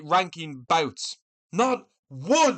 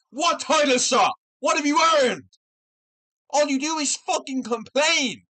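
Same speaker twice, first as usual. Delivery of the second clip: higher and louder, shouted challenge; angry shout; 7 semitones higher, 3 dB louder than usual. Real, not acted. Shouting down a fighter who demands a title shot, angry. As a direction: yell it right in his face.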